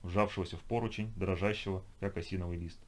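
ordinary delivery, nothing marked

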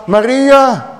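Maria called out high and loud, falling; ringing exclamation; exclaimed name. Acted. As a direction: wake her with her name. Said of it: "Er staat een uitroepteken bij".

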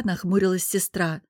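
plain reading voice

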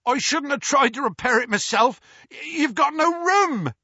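high, wailed self-blame; distressed moan. A narrator's reading. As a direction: lament it with pitch climbing. Hagrid moaning his apology.

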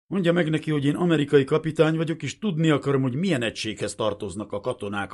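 loud, high, insistent protest; forceful official complaint; indignant demand. A narrator's reading. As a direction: demand answers as an outraged officer. Indignant captain demands answers.

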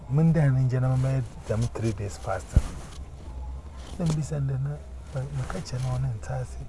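normal talking voice

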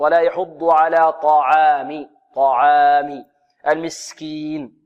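"طعام" slow with heavy T and thin open 'aa'; light open fatha. Shown as a demonstration. Teacher modelling the verse correctly, slow open light vowels. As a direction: recite "طعام" slowly, Ayn open and light. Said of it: "فتح مع الترقيق".

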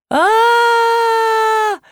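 long, very high scream; sustained shriek carrying through a house; piercing scream. A narrator's reading. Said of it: "полетел по дому пронзительный вопль".

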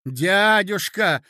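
protesting exclamation. Acted. Nephew accused by his uncle, protesting. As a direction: call out in protest.

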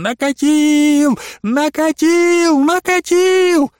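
очень высоко и медленно, всё выше; визжи всё исступлённее; неистовый визг, нарастающий. A narrator's reading. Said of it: "визжит неистовым голосом". (неистовый визг Иларии)

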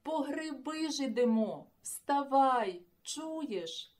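loud wake-up yell; shouting. Acted. Boy shouting to wake sleeping friend, loud. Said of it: "кричав Марусик".

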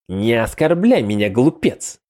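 scornful, looking down on him; contemptuous. Acted. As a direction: sneer it at a fool. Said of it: "презрительно молвил сундук".

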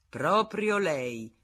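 slow, grand pronouncement; majestic. Acted. Senecal majestically pointing out Federico, very slow. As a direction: pronounce it with lofty dignity. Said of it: "disse maestosamente Senecal".

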